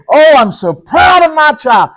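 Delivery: a father shouting his joy; proud exclamation. Acted. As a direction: burst out with fatherly pride. God exclaims his pride.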